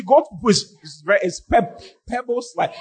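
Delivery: ordinary talk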